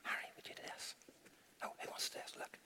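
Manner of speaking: unvoiced hushed aside; real whispered aside. Real, not acted. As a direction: whisper it so only the helper hears. Whispered aside among helpers.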